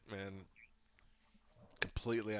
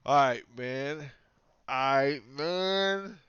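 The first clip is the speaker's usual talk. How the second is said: hyped up; 4 semitones higher, 12 dB louder, much slower than usual. Real, not acted. Hype greeting, louder each time.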